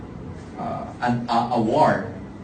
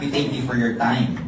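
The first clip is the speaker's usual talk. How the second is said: ordinary delivery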